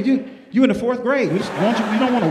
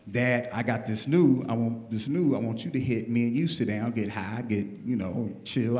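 relaxed son inviting his dad to chill; laid-back son; 9 semitones lower, 8 dB quieter than usual. Retold or imitated.

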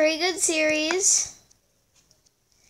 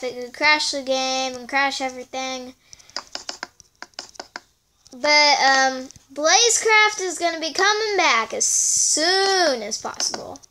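ordinary talk

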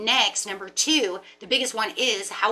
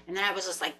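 ordinary delivery

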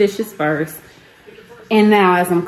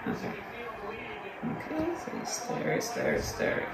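whispering; 4 semitones higher, 15 dB quieter than usual. Real, not acted. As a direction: whisper it gently. Whispering to toddler.

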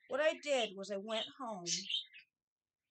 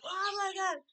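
shocked exclamation; 9 semitones higher, 6 dB louder than usual. Retold or imitated. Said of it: "he's like".

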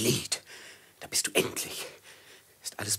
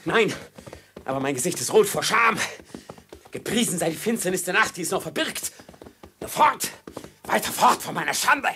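higher, louder urgent shame; frantic, ashamed urging; agitated; 7 semitones higher, 5 dB louder than usual. Acted. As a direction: push on, driven by shame. Fleeing man ashamed, urging on.

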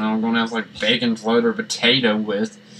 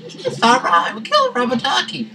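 high squeaky voice of fake sorrow; mock squeaky lament; 14 semitones higher than usual. Gamer laments the killed ducky in a high squeaky whine. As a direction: whine it like a wounded child.

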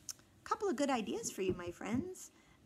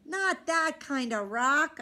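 groan; 4 semitones higher, 6 dB louder, slower than usual. A narrator's reading. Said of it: "I groaned".

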